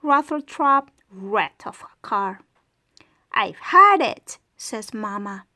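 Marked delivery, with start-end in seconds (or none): 3.3-4.4 fed-up mom. Acted. Mama fed up with car.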